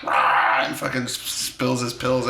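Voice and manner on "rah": breathless hammed-up growl of rage; mock angry roar. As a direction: fake choked roar. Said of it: "the guy's like".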